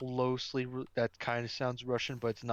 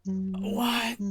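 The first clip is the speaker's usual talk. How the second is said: sharp, startled "What?"; surprised; 8 semitones higher, 6 dB louder than usual. Real, not acted. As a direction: blurt it out, thrown. Sudden outburst after long silence.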